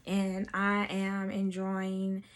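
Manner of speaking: usual speaking voice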